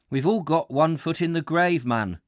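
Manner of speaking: Geordie cry. Retold or imitated. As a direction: cry out like an old Geordie railwayman. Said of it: "he cried".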